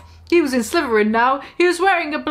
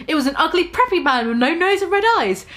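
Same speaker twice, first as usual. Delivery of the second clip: usual speaking voice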